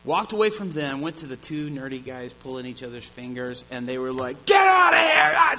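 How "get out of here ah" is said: much higher, much louder retold scream; angry scream. Drunk guys chasing the preachers away, furious shout. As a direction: hurl it at the intruders, full yell. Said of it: "screaming at us".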